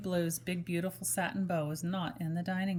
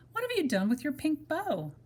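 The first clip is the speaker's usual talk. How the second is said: dog doll question; 7 semitones higher than usual. Acted. Toy dog doll asks.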